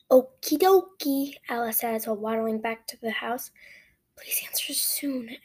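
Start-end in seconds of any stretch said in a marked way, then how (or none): 0.1-1.3 slow, louder, drawn-out okay; a touch of a cute child, stretched out; lightly voiced little Ella. A narrator's reading. Little Ella answers in story. 4.2-5.4 slow, hushed whisper. A narrator's reading. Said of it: "I whisper so only I can hear".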